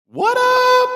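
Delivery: high, loud squealed greeting; hype greeting. Acted. Host greeting the chat, high loud squeal. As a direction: throw it out like a hype man. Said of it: "greeting repeated".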